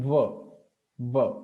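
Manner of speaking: 'va' as a v, not a rounded w; dental-labial v. Shown as a demonstration. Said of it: "upper teeth is coming in contact".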